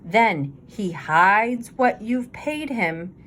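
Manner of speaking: ordinary delivery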